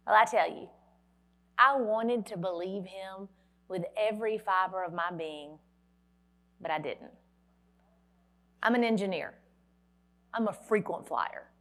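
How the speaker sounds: ordinary delivery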